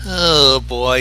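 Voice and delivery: ordinary delivery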